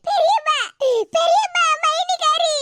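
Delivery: high, hammed-up blubbering; tattling in put-on tears; fake sobbing. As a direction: sob theatrically between the words. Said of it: "ஏன் இப்படி அழுதுகிட்டு இருக்க".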